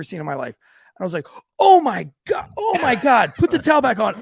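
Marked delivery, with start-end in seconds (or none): 1.6-4.2 high, loud horrified yell; shocked yelling. Retold or imitated. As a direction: yell in disgust to cover it up. Retold horror at naked man.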